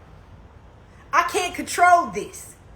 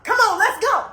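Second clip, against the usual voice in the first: high, heated call to fight; rally him to go fight now; pumped-up come on, let's go; fired-up challenge; 7 semitones higher than usual. Retold or imitated. Jumping up to fight.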